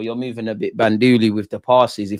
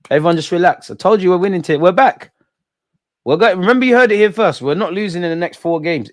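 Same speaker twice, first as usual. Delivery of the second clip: confident boast; 7 semitones higher, 4 dB louder than usual. Real, not acted.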